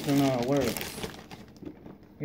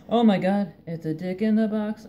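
mock shock; 7 semitones higher, 6 dB louder than usual. Fake shocked reveal joke.

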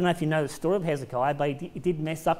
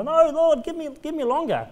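higher, pleading whine; whiny begging crybaby; 9 semitones higher, 3 dB louder than usual. Retold or imitated. Preacher mimics weeping Hezekiah begging God for more time.

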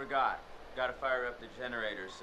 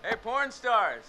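calling out; 10 semitones higher, 10 dB louder, much slower than usual. Acted. Game character calling out.

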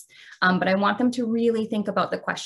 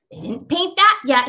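put-on loud, raised child's shout; 5 semitones higher, 7 dB louder than usual. A narrator's reading. Boy shouting at his parents over the chair. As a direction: yell it out in protest. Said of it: "he shouted".

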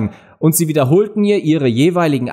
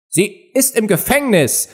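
böse Königin; 9 semitones higher than usual. A narrator's reading. Königin zum Henker.

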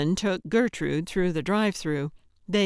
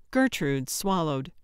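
ordinary delivery

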